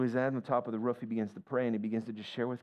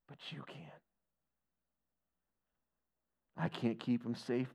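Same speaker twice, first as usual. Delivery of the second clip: quiet weeping prayer; 3 dB quieter, slower than usual. Retold or imitated. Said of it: "he began to weep and go".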